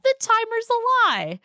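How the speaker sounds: high, loud punchline shouted with glee; excited catchphrase. Acted. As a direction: sell the catchphrase big. Show catchphrase punchline in intro.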